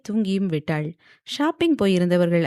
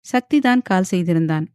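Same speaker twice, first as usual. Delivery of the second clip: ordinary delivery, nothing marked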